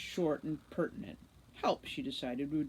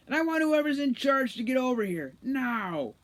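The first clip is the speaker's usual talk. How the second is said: 1 shouted demand; 8 semitones higher, 8 dB louder than usual. A narrator's reading. Root demands someone in charge.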